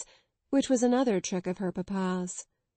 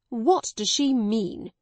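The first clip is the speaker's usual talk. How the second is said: cried; 2 semitones higher, 4 dB louder, slower than usual. A narrator's reading. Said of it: "cried Miss Minchin to Miss Amelia".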